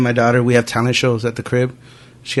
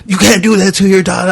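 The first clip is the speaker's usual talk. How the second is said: scolding mom; 7 semitones higher than usual. Retold or imitated. Retold mother scolding her son.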